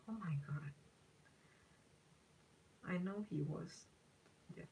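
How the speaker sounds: breathy real whisper. Real, not acted. Reacting to a viewer's comment about her ex, in a shocked whisper.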